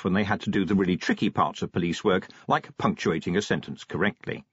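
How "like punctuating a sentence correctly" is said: plain reading voice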